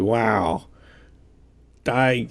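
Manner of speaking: usual speaking voice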